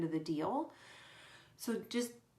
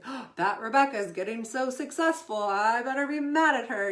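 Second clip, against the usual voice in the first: louder, higher catty gossip voice; mock envy, building louder; mock jealous gossip; 4 semitones higher, 7 dB louder than usual. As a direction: sneer it like a jealous rival.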